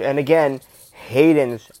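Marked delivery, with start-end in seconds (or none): none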